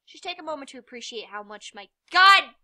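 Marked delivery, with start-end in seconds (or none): 2.1-2.5 sudden loud, higher shout; exasperated outburst. Real, not acted. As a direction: yell it out in exasperation. Exasperated at noisy dog.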